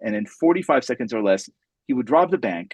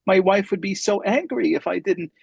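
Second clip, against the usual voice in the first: high, meek and coy; 8 semitones higher than usual. Retold or imitated. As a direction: play it innocent and sweetly coy. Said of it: "in a very coy voice".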